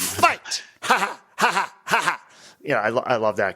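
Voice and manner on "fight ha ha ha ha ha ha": mock villain shout into staged laugh; deliberate, separated villain ha-has; Exilian imitation. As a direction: do a campy alien cackle after the command. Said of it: "It's got the Exilian guy".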